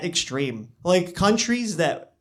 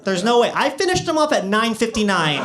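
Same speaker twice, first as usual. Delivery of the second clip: raised, higher-pitched comic outrage; mock indignation; 6 semitones higher, 3 dB louder than usual. Ticketed man complaining at home about the fine, mock indignant. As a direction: protest the injustice, voice climbing.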